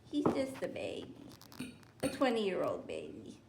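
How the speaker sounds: whisper. Real, not acted. Whispering about her dog.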